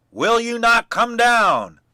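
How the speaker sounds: furious shout. A narrator's reading. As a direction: roar up at her, out of patience. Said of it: "cried Bluebeard".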